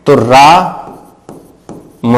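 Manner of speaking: रा-म with the aa held very long; रा-म drawn out as a pluta vowel; prolonged vowel. Shown as a demonstration. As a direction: stretch the aa in रा-म far beyond normal, slowly. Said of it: "अगर मुझे राम बोलना है".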